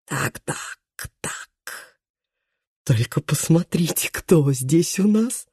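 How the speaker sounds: sneering, lisping thug, hushed and slow; mocking lisp. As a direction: leer and lisp through missing teeth. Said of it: "глумливый шепелявый голос".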